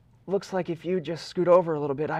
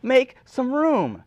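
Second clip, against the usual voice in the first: louder, higher, slowing desperate Jack; rising frantic plea; 9 semitones higher, 8 dB louder, slower than usual. Acted. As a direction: beg her louder and louder to shove over. Jack pleading loudly for room.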